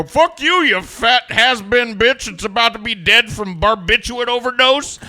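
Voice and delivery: loud high mock retort; imagined comeback. What he wished he had yelled at her, mock shout. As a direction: fire back the insult he never said. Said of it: "opportunity to go".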